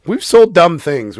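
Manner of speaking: loud, slow emphatic complaint; loud exclaim. Real, not acted. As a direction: drag it out loudly. Mocking dumb products, loud.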